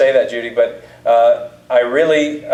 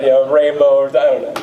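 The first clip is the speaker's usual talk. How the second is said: ordinary talk, nothing marked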